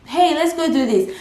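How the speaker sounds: high-pitched mock invitation; acted friend's invite. Friend inviting a student out, high eager invite. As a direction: play the keen friend, voice lifted high. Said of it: "say no".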